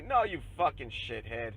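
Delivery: ordinary talk, nothing marked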